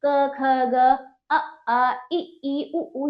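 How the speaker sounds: put-on each letter drawn out for children; slow letter recital. Shown as a demonstration. Teacher to children reciting letters, slow and drawn out. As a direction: say क, ख, ग slowly, one letter at a time. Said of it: "हमने अलग-अलग सब कुछ पढ़ा".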